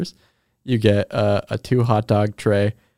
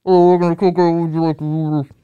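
high, muffled intercom worker; garbled, higher-pitched order-taker on a poor mic; drive-thru voice; 6 semitones higher, 5 dB louder than usual. Acted. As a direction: talk like a bad drive-thru speaker. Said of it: "I'm gonna do my actual drive-thru voice".

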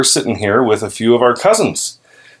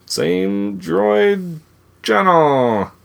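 announcer voice; 7 semitones higher, much slower than usual. Pretend. Mock announcer sign-off.

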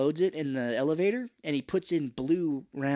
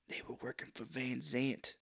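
faint dying voice; 12 dB quieter than usual. Retold or imitated. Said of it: "he was like".

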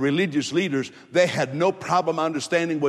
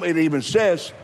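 usual speaking voice

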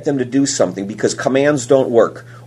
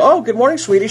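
higher, sing-song sugary greeting; mock sweet greeting; 10 semitones higher, faster than usual. Dad teasing his waiting daughter, sugary and higher. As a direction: play innocent with a sugary hello. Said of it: "And I came out and I go".

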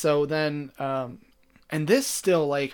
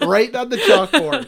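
laughing while repeating the phrase; high, loud, laughing as the phrase is savoured; 8 semitones higher, 10 dB louder than usual. Real, not acted. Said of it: "that's a good phrase".

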